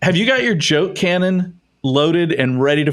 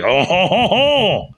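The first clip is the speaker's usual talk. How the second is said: mock French laugh; 5 semitones higher, slower than usual. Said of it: "you're like".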